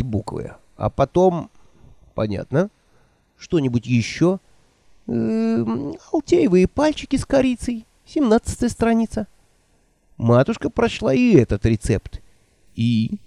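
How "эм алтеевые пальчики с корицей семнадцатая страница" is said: put-on higher, fluttery old woman voice. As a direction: play the flustered old witch. Nanny voiced by narrator.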